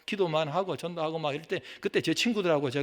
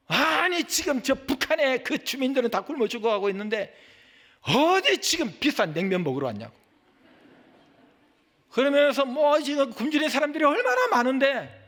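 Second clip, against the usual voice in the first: angry scolding; 9 semitones higher, 4 dB louder than usual. Retold or imitated. Old man railing at pastors queuing for naengmyeon, angry scolding. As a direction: scold loudly, pitched well up. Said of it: "우리 보고 욕을 하는 거예요".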